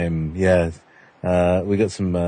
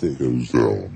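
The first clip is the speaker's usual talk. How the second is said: much lower processed voice; pitch shifted down; 10 semitones lower, slower than usual. Shown as a demonstration. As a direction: drop it deep through the shifter. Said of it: "if it turns it to the left".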